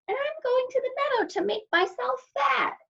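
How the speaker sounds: high, tiny goat voice; very small voice. A narrator's reading. Littlest goat answering the troll, very small high voice. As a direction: squeak it as the littlest billy goat. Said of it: "in his very small voice".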